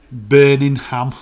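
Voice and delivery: Birmingham loud and drawn out, every syllable; exaggerated place name. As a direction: stretch Birmingham out loudly. Reading out American-style place name.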